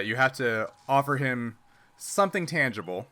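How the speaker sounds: ordinary talk, nothing marked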